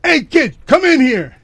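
high, loud call across the room. Retold or imitated. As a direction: call the kid in loudly. Lady calls the kid in.

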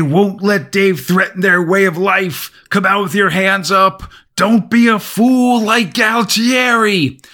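army megaphone call. Acted. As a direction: bark it out like a soldier. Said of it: "Then the army calls".

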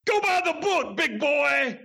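high put-on tough-guy voice quoting the wrestler; quoting Doctor D. Host quoting the wrestler's answer to his question, high put-on voice. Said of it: "What did he say?".

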